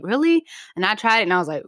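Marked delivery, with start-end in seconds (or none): 0.0-0.4 squeaky high disbelieving really; surprised. Retold or imitated. Retelling her reply to her brother with high surprise. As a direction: shoot up in mock surprise.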